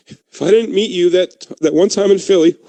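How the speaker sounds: high, choked-up tribute, played for laughs; hammed-up sentimental toast, slowing at the start; mock emotional toast. Friend toasting Keith, mock emotional and struggling. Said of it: "ooh, this is hard".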